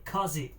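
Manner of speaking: COZY said with an open AH, "KAH-zee"; own pronunciation. Real, not acted. Streamer reading out the word of the day, own pronunciation. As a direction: say COZY as "KAW-zee", open first vowel. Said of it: "That's the way I pronounce it".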